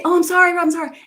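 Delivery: high, repetitive apology; over-apologetic. Acted. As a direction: act out someone apologizing too much. Demo of needless repeated apology.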